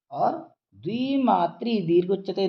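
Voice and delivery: ordinary talk, nothing marked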